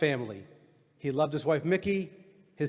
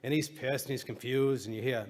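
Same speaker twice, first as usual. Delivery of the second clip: ordinary talk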